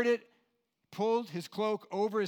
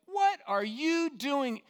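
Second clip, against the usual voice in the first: slow, much higher, louder angry demand; 8 semitones higher, 5 dB louder, much slower than usual. Shown as a demonstration. Preacher showing the wrong angry tone of God's question, loud and slow. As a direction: snap it like a scolding. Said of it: "hear that with some sort of anger".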